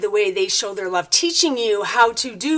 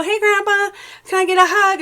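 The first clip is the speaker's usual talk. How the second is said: much higher, bright, eager ask for a hug; cheerful request to grandpa; 10 semitones higher, 4 dB louder than usual. Retold or imitated. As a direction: pop the voice up high like a hopeful grandkid. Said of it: "if you just speak up and go".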